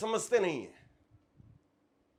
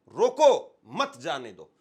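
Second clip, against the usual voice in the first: loud punched रोको, pause, then lower मत जाने दो; slow, with रोको set apart loudly; comma placement; 5 semitones lower, 8 dB louder, slower than usual. Shown as a demonstration. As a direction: break after रोको and stress it. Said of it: "coma कहाँ लगाना है".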